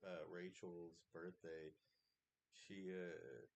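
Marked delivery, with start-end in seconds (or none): none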